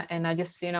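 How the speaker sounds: normal talking voice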